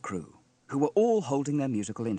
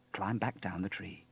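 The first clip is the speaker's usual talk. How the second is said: ordinary delivery, nothing marked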